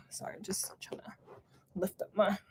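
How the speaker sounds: hushed unvoiced whisper while fiddling with something; quick apologetic whisper off-mic. Real, not acted. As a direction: drop to a whisper as you apologise. Apologising mid-stream while adjusting.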